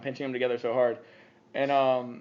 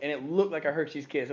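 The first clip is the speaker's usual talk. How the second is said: normal talking voice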